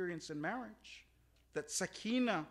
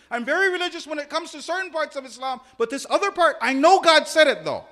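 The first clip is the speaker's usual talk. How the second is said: raised, high-pitched, forceful objection; loud protesting; 7 semitones higher, 19 dB louder than usual. Acted. Selective believer making excuses about Islam, loud and insistent. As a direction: argue it loudly at high pitch.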